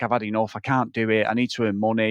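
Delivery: low, subdued quoted voice; retold fed-up quitter. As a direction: imitate someone giving up, low and soft. Retold man backing out of practice.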